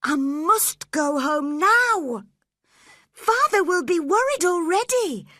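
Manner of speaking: put-on upset girl. A narrator's reading. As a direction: insist on going home, worried. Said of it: "said Cathy".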